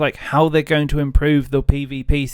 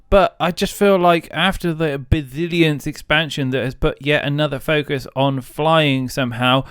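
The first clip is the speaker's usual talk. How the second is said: ordinary talk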